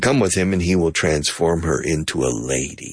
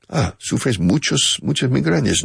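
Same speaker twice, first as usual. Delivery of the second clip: listener voice; 7 semitones higher than usual. Acted. Voicing a listener.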